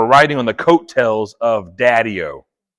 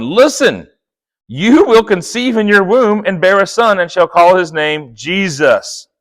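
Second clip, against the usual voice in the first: scripture reading; 5 semitones higher, 5 dB louder than usual. Acted. Reading scripture aloud, raised.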